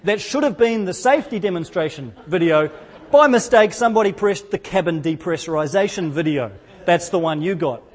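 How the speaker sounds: usual speaking voice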